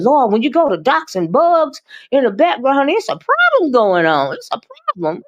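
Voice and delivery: high-pitched comic exclamation; mock alarm. Telling the chat about bug doxing, in high mock alarm. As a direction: pitch it way up in playful disbelief.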